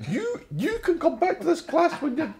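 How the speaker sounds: teacher impression. Quoting the sitcom teacher to old colleagues, laughing high and loud. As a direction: scold like the sitcom teacher, barely holding the laugh. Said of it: "remember the teacher that Camden Bar played".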